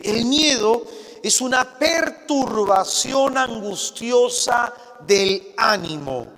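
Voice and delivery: ordinary delivery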